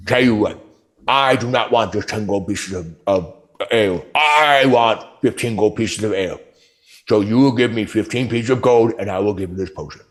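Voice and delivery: lightly voiced ogre haggling. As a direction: bargain like a dim ogre.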